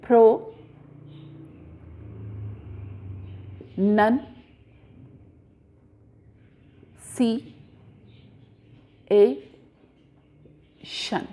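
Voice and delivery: each syllable separated by long pauses. Shown as a demonstration. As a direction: break it into five isolated units, pro, nun, si, a, shun. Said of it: "you can pronounce this word".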